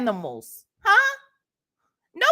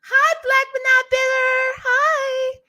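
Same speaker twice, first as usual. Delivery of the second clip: sing-song greeting; 11 semitones higher, 3 dB louder than usual. Real, not acted. High sing-song greeting to viewer.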